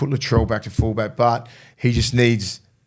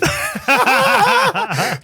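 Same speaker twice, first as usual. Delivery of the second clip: high burst of laughter at his own slip; laughing at himself. Real, not acted. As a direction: break into a real laugh at the mistake.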